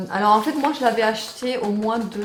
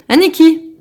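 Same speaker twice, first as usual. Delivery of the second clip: pet talk; 7 semitones higher, 12 dB louder than usual. Real, not acted. Talking sweetly to her pet.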